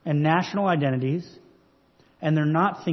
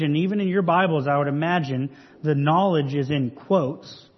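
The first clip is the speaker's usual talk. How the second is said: usual speaking voice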